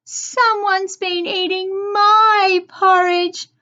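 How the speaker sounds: high, loud little bear cub; Baby Bear, small crying voice. A narrator's reading. Baby Bear finds his porridge eaten, a high upset cry. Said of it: "cried Baby Bear in a small voice".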